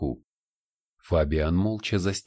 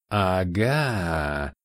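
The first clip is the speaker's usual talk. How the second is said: gloating demon hiss. Acted. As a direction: hiss it out in triumph. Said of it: "послышалось шипение суккуба".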